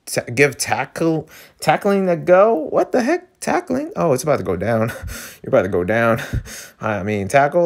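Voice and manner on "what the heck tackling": high squeaky disbelief; can't believe it; much higher shocked question. Real, not acted. Reacting to tackling.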